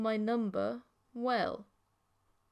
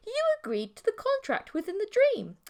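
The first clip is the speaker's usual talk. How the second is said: put-on high girl voice; 12 semitones higher, 4 dB louder than usual. A narrator's reading. Creature high voice.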